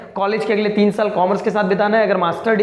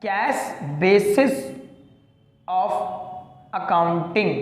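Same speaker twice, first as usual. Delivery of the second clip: each word drawn out slowly for notes; slow dictation; 4 dB quieter, much slower than usual. Shown as a demonstration. Teacher dictating a term to the class, very slow and deliberate. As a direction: spell it out slowly so students can write. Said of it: "कौन सी?".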